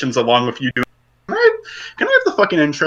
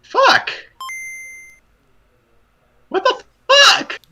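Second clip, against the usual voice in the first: frustrated shout; much slower than usual. Real, not acted. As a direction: yell it out in frustration. Frustrated at a cutting connection.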